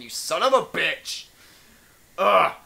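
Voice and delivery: hammed-up angry threat; mock angry. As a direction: fake rage at the editor.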